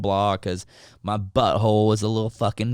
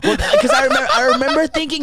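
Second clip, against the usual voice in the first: laughing; 6 dB louder, faster than usual. Real, not acted. Laughing through story.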